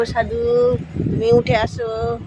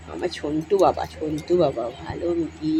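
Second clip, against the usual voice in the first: tender cooing; 5 semitones lower than usual. Real, not acted. Cooing praise to pet.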